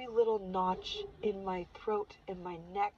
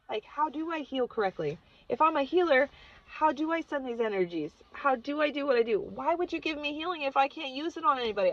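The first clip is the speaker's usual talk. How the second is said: higher, louder string of questions; pleading, worked-up and higher-pitched; exasperated questioning; 6 semitones higher, 6 dB louder than usual. Real, not acted. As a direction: fire off questions in frustration. Venting questions she never asked.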